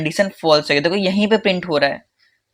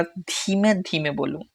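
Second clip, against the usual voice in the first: hushed, voice dropped right down; demonstrating too low; 9 dB quieter than usual. Shown as a demonstration. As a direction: show an overly soft way of talking. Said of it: "ऐसे नहीं".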